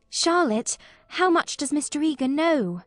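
put-on a worried girl confiding in her cousin; anxious young girl.

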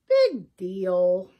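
a goat's catchphrase, lightly voiced; Nina. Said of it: "the one thing she says over and over".

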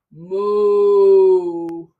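low playful cow moo. Pretend. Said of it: "I lower my belly down and I say".

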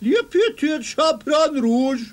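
high falsetto disguise; fake little girl voice.

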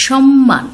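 সম্মান with the m clearly held double; সম্মান said "shom-man", lingering on the m; doubled m. Shown as a demonstration. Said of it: "দুটো ম এখানে দ্বিত্ব হয়েছে".

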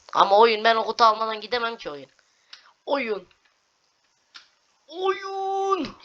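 high, loud pleading; whiny plea. Real, not acted. Gamer whining at the game to let them pass. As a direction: whine at the game.

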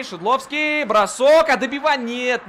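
high, loud quick call; excited play-by-play. Real, not acted. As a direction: call the shot sharply. Chance at net. (взволнованный репортаж)

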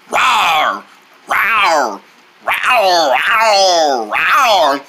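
repeated falling rawr; pretend dinosaur roar. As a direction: roar playfully like a toy dinosaur. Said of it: "He's pretending to be a dinosaur".

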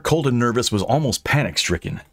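usual speaking voice

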